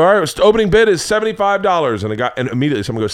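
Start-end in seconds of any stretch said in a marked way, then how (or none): 0.0-2.0 loud, higher auctioneer opening; mock auctioneer calling the bid. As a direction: announce the bid to the room.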